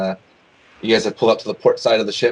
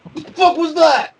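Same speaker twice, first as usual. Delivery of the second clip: high, loud startled bandit shout; 4 dB louder than usual. Acted. Bandit turning round at a noise, startled shout. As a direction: bark it out, suddenly alarmed.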